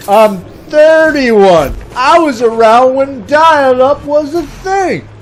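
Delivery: mock indignation. Older man correcting young classmate, slow indignation. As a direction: stretch it out, comically affronted.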